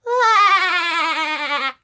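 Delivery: long, loud, high vibrating laugh; SpongeBob. Shown as a demonstration. Streamer copying the voice actor, long SpongeBob laugh. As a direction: hold a high laugh and make it judder. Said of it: "Except he goes like".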